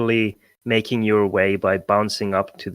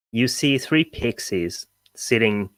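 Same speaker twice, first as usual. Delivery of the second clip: ordinary delivery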